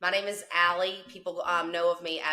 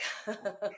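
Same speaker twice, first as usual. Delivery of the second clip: breathy laugh; 4 semitones higher, 7 dB quieter than usual. Real, not acted. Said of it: "Yeah, exactly".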